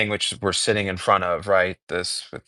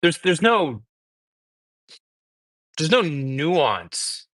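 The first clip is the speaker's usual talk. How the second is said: troubled, halting conviction. Real, not acted. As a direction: struggle for words, deeply bothered by an injustice. Halting, upset moral condemnation.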